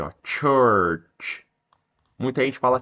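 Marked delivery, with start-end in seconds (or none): 0.2-1.4 "Church" drawn out, both "tch" sounds stressed; som de ch. Shown as a demonstration. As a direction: model "Church" slowly, releasing each ch. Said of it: "Ó o C H aqui". ("Church" esticado, marcando o "tch")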